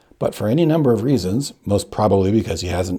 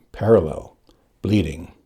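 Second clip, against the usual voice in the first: usual speaking voice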